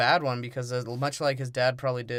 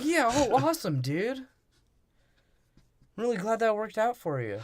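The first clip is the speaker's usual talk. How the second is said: high, hammed-up cheering voice; mock congratulation; 10 semitones higher than usual. Crewmates congratulating the sailor, mock delight. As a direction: cheer him on like an impressed buddy.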